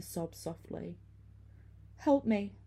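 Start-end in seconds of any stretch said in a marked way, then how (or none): 2.0-2.6 higher, pleading call for help; plaintive cry. A narrator's reading. Ghostly woman calls from behind, plaintive. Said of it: "she cried plaintively".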